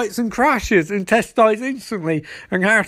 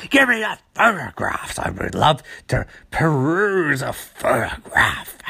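Trickster; 5 semitones lower than usual. Retold or imitated. Villain demanding photographs, theatrically menacing. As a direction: relish each word in a theatrical villain voice. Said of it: "and he's like".

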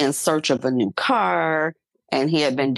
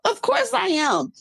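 mock tearful reply, high. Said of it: "And I'm like".

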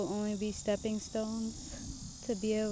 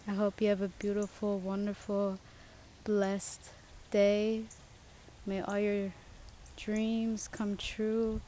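slow, soft inspirational send-off; gentle blessing; 3 dB quieter than usual. Acted. Podcaster blessing her listeners, slow soft inspirational tone. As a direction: bestow wishes slowly like a benediction.

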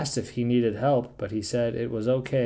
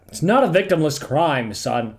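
stern dad; 6 semitones higher, 7 dB louder than usual. Pretend. Mock scolding about piracy.

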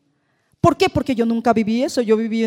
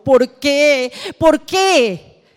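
anguished plea; 5 semitones higher, 6 dB louder, much slower than usual. Retold or imitated. Voicing a suffering person's lament.

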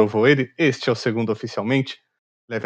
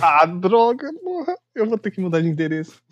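squeaky jokey alarm, higher; mock panic; 7 semitones higher than usual. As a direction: pretend to panic, voice jumping up. Mock panic at being exposed.

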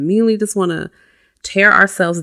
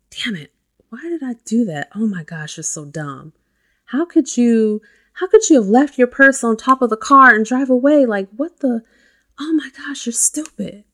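harsh self-scolding; 6 semitones higher, 5 dB louder than usual. Shown as a demonstration. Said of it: "How are you talking to yourself? Are you being harsh?".

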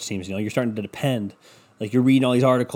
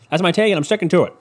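higher, louder and emphatic; playfully insistent, raised pitch and volume; mock-firm declaration; 5 semitones higher, 5 dB louder, faster than usual. Podcaster standing by his take, mock-firm and louder.

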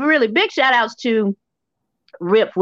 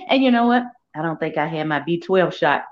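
ordinary talk, nothing marked

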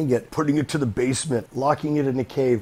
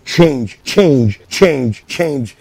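louder, insistent repeated nag; nagging water; 8 dB louder, much slower than usual. Pretend. Water nagging the rock, loud repeated insistence. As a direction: drip the word like a nag.

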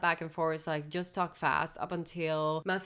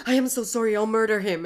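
loud mock pleading; 2 semitones higher, 10 dB louder, faster than usual. Guard on knees begging Quintus.

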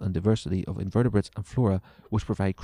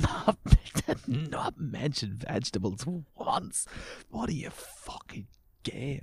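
mock whisper taunt; 6 semitones higher, slower than usual.

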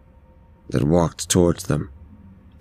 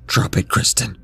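higher-pitched order, volume held back; mild narrated yell; 4 semitones higher than usual. A narrator's reading. Donovan orders Kristen to drop it, a mild yell. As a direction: give an urgent command as an understated yell. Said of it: "Donovan didn't have to yell twice".

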